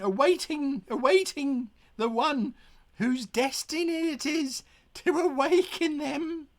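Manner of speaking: first mate voicing an eerie legend. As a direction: tell the legend like a spooky tale.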